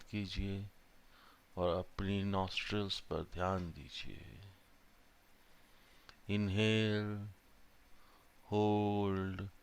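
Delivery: slow, drawn-out meditation voice with long pauses; guided meditation. Real, not acted. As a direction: guide them calmly through each breath, unhurried. Leads a breathing meditation. (धीमी, शांत ध्यान कराने वाली आवाज़)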